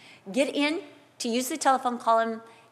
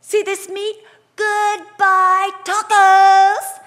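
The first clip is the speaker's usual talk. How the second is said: high, loud mock-furious yell that builds to the last word; a hammed-up angry ultimatum, getting louder to the end; angry shout; 10 semitones higher, 13 dB louder, much slower than usual. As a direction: threaten to dump the tacos, shouting. Threatening husband with taco meat.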